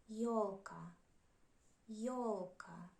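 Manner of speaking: ёлка said "YOL-ka", first syllable stressed. Shown as a demonstration. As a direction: lean on the Ё that opens ёлка. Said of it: "It is always stressed".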